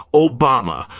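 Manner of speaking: loud, high call of the name. Acted. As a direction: shout the name like a stage cue. Mocking skit, naming [name].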